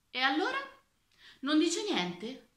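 prodding weaver. A narrator's reading. Weaver to the silent old minister, prodding.

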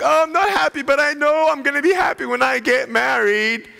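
high, whiny imitation of a single person; mock whiny single person. Preacher mocking single people who pin happiness on marriage, whiny. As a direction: lightly mimic someone pining for marriage. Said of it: "Some single people are like".

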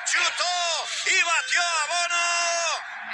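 loud, fervent radio goal recap; shouted goal commentary. Real, not acted. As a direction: roar the goal story to the fans.

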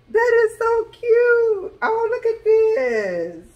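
high, loud, slow delighted squeal; overjoyed high-pitched gushing. Real, not acted. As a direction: gush over the gift in a high squeal. Delighted gasp at wreath.

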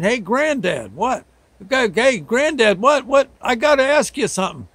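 high, loud call to Granddad; hollering. Retold or imitated. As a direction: yell up to him from the roof.

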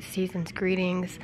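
ordinary talk, nothing marked

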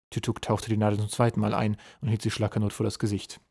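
ordinary delivery

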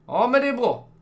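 high, loud upbeat; pleased. Real, not acted. Gamer pleased the choices match others, high and bright. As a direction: cheer the result.

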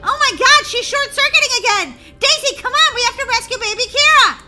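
high, loud urgent shouting; rally your sister at the top of your voice; acted panic. Sisters rushing to rescue the baby, panicked shouting.

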